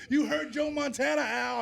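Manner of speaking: high, loud mock shout at Al. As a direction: yell it like an angry fan, half joking. Brother shouts at Al again.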